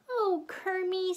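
high, lovestruck coo; adoring diva. A narrator's reading. Miss Piggy about to smother Kermit with kisses, adoring coo.

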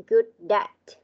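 "Good at" joined like "goo-dat"; linked sounds. Shown as a demonstration. Teacher asking pupil to reread, linked "Good at" model. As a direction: carry the d of "Good" straight into "at". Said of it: "chỗ này là chỗ nối âm".

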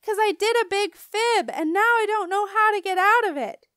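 high, loud frantic confession; distressed shout. A narrator's reading. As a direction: shout it out in a fix. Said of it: "I shouted some more".

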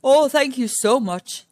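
an over-sweet grateful lion; gushing Lion. Acted.

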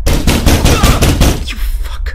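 loud, high, breathy outburst; startled yell. Real, not acted. Gamer yelling at a monster in the hallway. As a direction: scream it at the thing that jumped out.

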